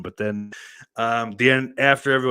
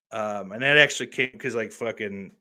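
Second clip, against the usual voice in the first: usual speaking voice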